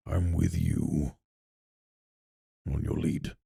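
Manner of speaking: soft, slow and low, calm and steady. Retold or imitated. The warrior telling the party, calm and quiet. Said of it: "with a calm voice".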